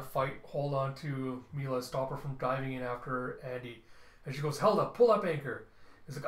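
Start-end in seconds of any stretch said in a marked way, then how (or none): 4.6-5.7 barked order. Acted. Captain giving orders in battle.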